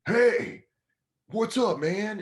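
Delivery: high-pitched mock fan hail; overfriendly greeting. Bar patron greets the comic, overfriendly.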